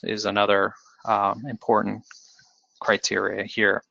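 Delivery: usual speaking voice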